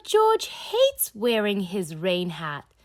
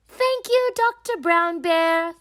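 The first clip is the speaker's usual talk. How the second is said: Mummy Pig; 9 semitones higher, 6 dB louder than usual. Acted. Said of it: "says Mummy Pig".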